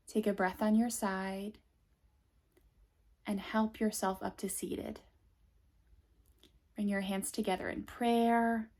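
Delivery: normal talking voice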